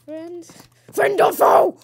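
high, loud sentry call; mock guard challenge. Gamer challenging a stranger, mock guard call. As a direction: bellow it like a sentry.

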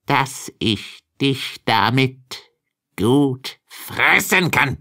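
slow, dark wolf voice dropping the disguise; wolf answers the girl before he pounces; menacing wolf. Acted. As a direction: let the beast show through, slowly. Wolf reveals himself.

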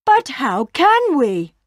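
high, slow worried question; doubtful cow. A narrator's reading. Bella asks worriedly.

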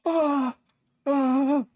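high pained crying gasps. Real, not acted. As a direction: gasp and whimper from the heat. Hot sauce burning mouth.

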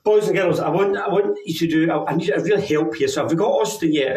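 ordinary talk, nothing marked

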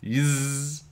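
high, buzzing fly saying Yizz; buzzy fly yes. A narrator's reading. Fly proudly answering the boy in a high buzz. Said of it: "said Fly Guy".

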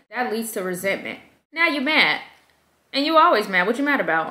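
ordinary talk, nothing marked

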